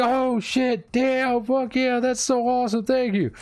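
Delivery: high-pitched imitation of a thrilled man; super excited. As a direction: gush like someone just given a prize. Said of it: "He's, like, super excited".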